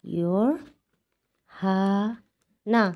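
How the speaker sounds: Your হানা stretched slowly as "yor... ha... na"; syllable by syllable. Shown as a demonstration. Teacher sounding out Korean eleven for learners, your হানা very slow. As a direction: pull your হানা apart with long pauses.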